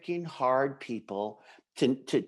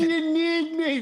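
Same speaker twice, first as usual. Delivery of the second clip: high-pitched mock disbelief; indignant protest; 6 dB louder than usual. Club officer left out of a private talk, indignant. Acts out an excluded club member.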